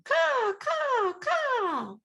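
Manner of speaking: high, sharp crow caws; crow cawing. A narrator's reading. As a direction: caw like a mother crow flying off.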